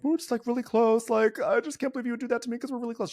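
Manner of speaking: high, put-on wounded voice; teary Kyle impression. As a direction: whine it like a hurt friend. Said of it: "been like".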